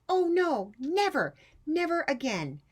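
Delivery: put-on high, loud, upset child vow; Rosie's dismayed vow. Rosie vowing to herself never to build again, upset. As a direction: swear it off like a mortified kid. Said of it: "who thought".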